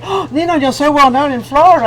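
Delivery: imitated gush, lifted high at first; gushing fan. Someone from Florida telling the host she's well known, gushing. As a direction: play an impressed viewer's gushing compliment.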